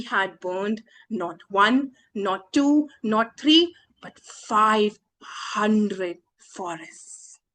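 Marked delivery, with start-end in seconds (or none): none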